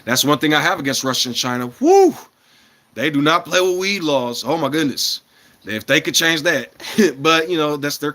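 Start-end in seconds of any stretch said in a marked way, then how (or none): 1.8-2.1 excited whoop. Real, not acted. Exclaiming about weed laws.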